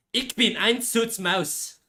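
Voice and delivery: hammed-up German accent, stretching each word; aggressive German accent. Streamer trying out German for chat, aggressive German accent. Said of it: "only way I can do a German accent".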